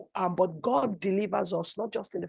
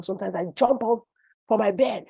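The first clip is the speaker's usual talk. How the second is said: ordinary talk, nothing marked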